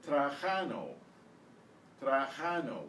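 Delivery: "Trajano" as trah-KHAH-noh; exaggerated Spanish pronunciation. Shown as a demonstration. Teacher modelling the drill word "Trajano", raspy Spanish J. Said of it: "trying to exaggerate a little bit".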